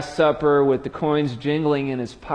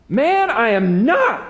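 higher, louder, slow flat denial; forceful denial; 10 semitones higher, 5 dB louder, slower than usual. Acted. Peter to the accuser by the fire: loud slow denial. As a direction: shut the accusation down hard.

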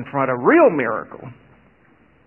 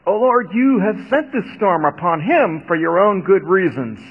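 earnest pleading; 6 semitones higher, 4 dB louder than usual. A narrator's reading. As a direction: beg not to be blamed. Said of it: "they pleaded".

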